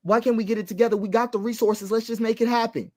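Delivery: ordinary delivery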